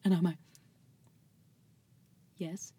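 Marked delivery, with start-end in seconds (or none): 2.4-2.7 tiny, soft reply; mock meek. Answering a friend who asks her to reason, mock meek. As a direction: give in very quietly, after a long pause.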